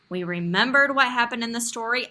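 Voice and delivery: ordinary talk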